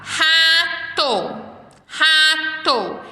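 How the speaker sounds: RATO high and held, the final TO separated; syllables sounded out. Shown as a demonstration. Teacher showing children the ending sound, syllables sounded out. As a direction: say "Rato" as ra, to, each syllable drawn out. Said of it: "terminar com o mesmo som".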